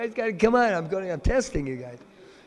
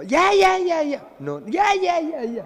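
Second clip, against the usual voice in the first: very high, loud squeaky chorus of yeah; squealing friends urging Radharani on; pushy girlfriends; 11 semitones higher, 5 dB louder than usual. Acted. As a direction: egg her on like giddy teens. Girls urge her again.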